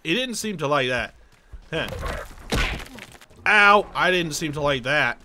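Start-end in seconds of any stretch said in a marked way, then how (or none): 3.5-3.9 loud, higher yelp; startled ow. Real, not acted. Gamer hit by creature he punched, loud ow. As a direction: cry out at a sudden hit.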